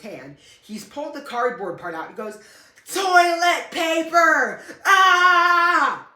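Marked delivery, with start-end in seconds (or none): 2.9-4.6 very high, loud, drawn-out holler; imitated manic yell. Brother bursts out of the bathroom, imitated manic yell. 4.8-6.1 long, high, loud held "ah"; imitated scream stuck on one note; monotone yell. Brother with toilet paper in hand, imitated monotone yell. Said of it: "super monotone".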